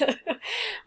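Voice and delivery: high amused giggle; short laugh. Real, not acted. Laughs mid-reading.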